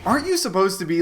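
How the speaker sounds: ordinary talk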